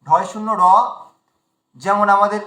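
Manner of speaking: ordinary delivery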